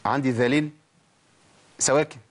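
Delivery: ordinary delivery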